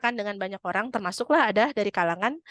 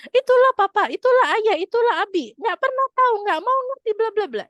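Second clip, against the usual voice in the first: much higher, louder shrill outburst; angry rant; 12 semitones higher, 7 dB louder than usual. Retold or imitated. Wife blowing up at husband, loud high rant. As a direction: unload on him in a fury.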